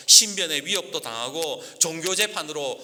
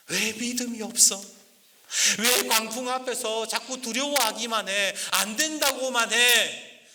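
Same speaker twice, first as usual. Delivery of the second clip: higher pleading challenge; Jesus challenging; 7 semitones higher than usual. Acted. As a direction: press the disciples as Jesus. Preacher voices Jesus's challenge. (제자들에게 따지는 예수님, 높은 목소리로)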